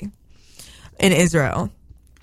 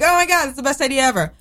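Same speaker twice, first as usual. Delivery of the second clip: excited; 6 semitones higher, much faster than usual. Retold or imitated. Excited reaction quoted.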